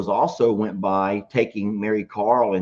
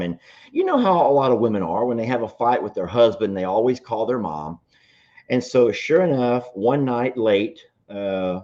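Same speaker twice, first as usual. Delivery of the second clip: normal talking voice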